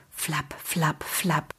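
soft distant flapping; hushed wingbeats. A narrator's reading. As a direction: breathe the wingbeats as if far above. Dragon wingbeat again, hushed.

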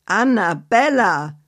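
slow, drawn-out reproaching call of her name; mild rebuke. Acted. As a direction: reprimand her gently but firmly. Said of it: "rief Badabamba".

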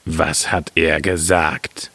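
low, slow miller voice; gespannter Müller. A narrator's reading. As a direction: ask slowly, deep in the miller's voice. Miller asks about prophecy, low voice. (gespannter Müller, tief und langsam)

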